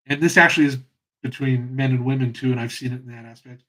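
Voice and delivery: ordinary talk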